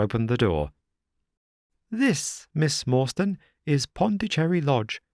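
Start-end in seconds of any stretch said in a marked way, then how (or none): 1.9-5.0 higher, fussy gentleman's voice; a nervous little host presenting the house; Thaddeus Sholto. A narrator's reading. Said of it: "said Mister Thaddeus Sholto".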